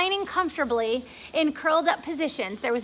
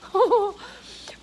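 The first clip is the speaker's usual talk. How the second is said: creepy cackle; 9 semitones higher, 4 dB louder than usual. Acted. Spider cackles at James.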